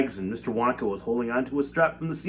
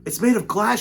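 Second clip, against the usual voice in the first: higher, louder panicked protest; frightened shout; 9 semitones higher, 6 dB louder than usual. A narrator's reading. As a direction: shout it up high in fear. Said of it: "shouted Grandpa Joe".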